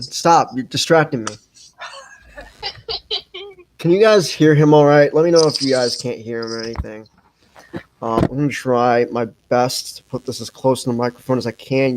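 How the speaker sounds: ordinary talk, nothing marked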